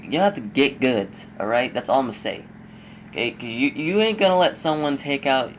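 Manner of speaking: ordinary talk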